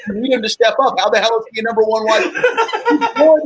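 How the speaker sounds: high, worked-up fan rant; excited rant. Real, not acted. A fan venting about his football team, high and worked up. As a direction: rant at the team's choices.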